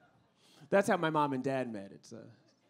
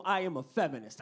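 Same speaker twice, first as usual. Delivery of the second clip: ordinary talk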